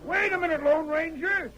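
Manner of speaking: urgent call to the departing hero. Acted. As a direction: call him back across the room. Judge calls after departing Ranger.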